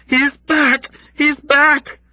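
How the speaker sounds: high, loud hysterical chant; loud frenzied cries; hysterical calling. Acted. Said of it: "calling out hysterically".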